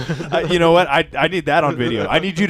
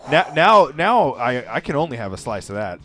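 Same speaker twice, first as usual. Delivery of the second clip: ordinary talk, nothing marked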